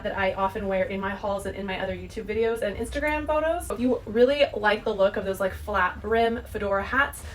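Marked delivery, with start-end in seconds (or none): none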